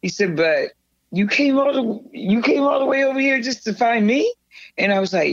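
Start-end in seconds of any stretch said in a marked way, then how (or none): 0.4-4.3 higher, rising incredulous question; touched disbelief. Retold or imitated. David learns she came to find him, amazed. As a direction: imitate amazement, lifting to a question.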